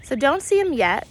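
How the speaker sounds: ordinary delivery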